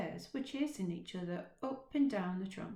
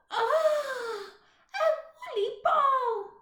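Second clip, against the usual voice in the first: high, loud cry of delight; 11 dB louder, slower than usual. Acted. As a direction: squeal at finding a toy. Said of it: "cried the little girl".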